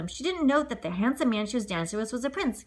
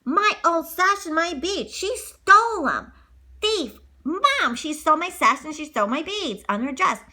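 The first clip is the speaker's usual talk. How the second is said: high, louder, slow accusing whine; angry stepsister; 7 semitones higher, 4 dB louder, slower than usual. Acted. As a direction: tattle angrily to Mom.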